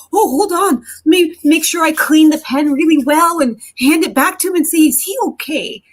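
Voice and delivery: high, eager mock people-pleaser. Woman mocking a people-pleaser rushing to help, high eager chirp. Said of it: "you go".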